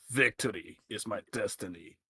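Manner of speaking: low, soft movie trailer voice; trailer guy voice. Pretend. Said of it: "Meta Knight as trailer guy goes".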